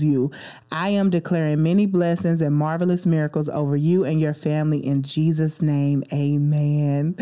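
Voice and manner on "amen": ordinary talk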